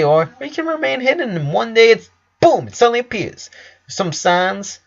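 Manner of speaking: brisk doctor. Acted. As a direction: lecture the boy like a smug physician.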